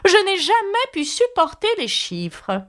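irritated grand lady; Duchess. Acted. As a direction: huff it out with disdain. Duchess voiced by narrator.

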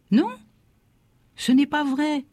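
put-on mild, high-pitched child's protest; child's cry. A narrator's reading. Boy contradicting his father in class, mildly cried out. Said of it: "je criais".

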